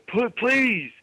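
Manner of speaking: high, slow pleading wrestler promo; over-the-top wrestling promo plea; Ric Flair rant. Retold or imitated. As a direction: beg to be put in the cage, drawn out and high. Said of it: "He says".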